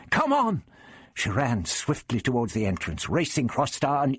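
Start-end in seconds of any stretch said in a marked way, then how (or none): 0.1-0.6 high, eager call; urging on. A narrator's reading. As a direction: call them after you. Barbara urging the others forward.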